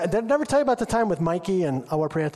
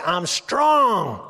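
shouted declaration; 4 dB louder than usual. Shown as a demonstration. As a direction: shout it out, loud and proud. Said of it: "you're supposed to say".

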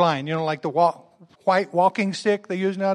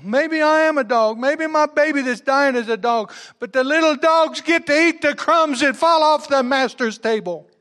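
higher, louder insistent pleading; earnest plea; 8 semitones higher, 5 dB louder than usual. Acted. A mother answering Jesus about the dogs, urgent raised plea. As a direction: press the point, urgent and raised.

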